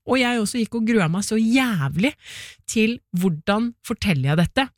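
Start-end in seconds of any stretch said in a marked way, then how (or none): none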